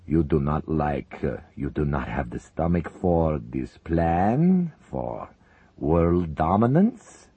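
slow, heavy voice of a menacing general secretary; Soviet leader. Acted. Zelinkov to his uneasy comrades, slow heavy menace. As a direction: savor each word like a man in power.

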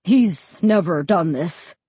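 slow, understated apology; apologetic. A narrator's reading. Dad to the realtor about the dog, mildly apologetic. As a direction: excuse the dog, a touch embarrassed. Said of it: "Dad said apologetically".

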